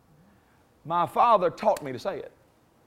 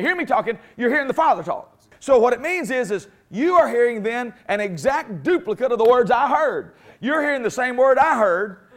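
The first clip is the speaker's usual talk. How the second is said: louder, driving, rising preacher cadence; full-force preaching; 6 semitones higher, 6 dB louder than usual. Real, not acted. Preacher to congregation, loud rising full-force preaching. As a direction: build the sermon to a loud peak.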